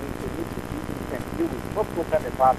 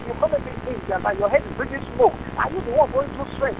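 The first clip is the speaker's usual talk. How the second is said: much higher, heated retort; shrill indignant yell. Real, not acted. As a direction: fire the question back at him. Caller shouts back in argument.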